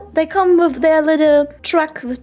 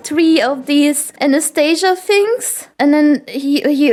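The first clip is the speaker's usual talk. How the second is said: ordinary delivery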